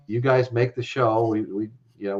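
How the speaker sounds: normal talking voice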